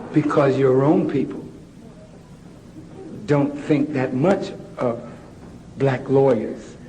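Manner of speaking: very low, measured teacher with long pauses; discouraging teacher. Retold or imitated. Preacher quoting the teacher who discourages Malcolm, low measured teacher voice. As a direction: drop right down and let the pupil down in measured phrases. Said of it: "The teacher said".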